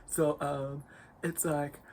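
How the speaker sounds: slow, self-serious gamer voice. Shown as a demonstration. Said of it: "let me try to take a gamer voice".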